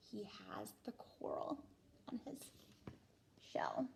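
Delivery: hushed aside; whisper. A narrator's reading. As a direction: drop to a soft whisper.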